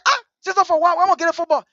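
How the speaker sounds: put-on high, loud childlike objection; retold protest. Little brother questioning big sister's order, loud protest. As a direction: plead the case, pitched well up.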